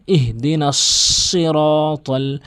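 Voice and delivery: "সিরাতাল" slow, with a long heavy s; emphatic sad. Shown as a demonstration. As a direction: recite "ইহদিনাস সিরাতাল", holding the thick s. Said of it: "ভালো করে করবেন". (ভারী সাদে "ইহদিনাস সিরাতাল")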